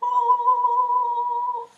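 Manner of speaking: a high, drawn-out ooh; drawing sound effect. Pretend. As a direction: sing out a long high ooh as the pencil moves. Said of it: "you're gonna go".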